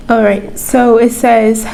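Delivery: usual speaking voice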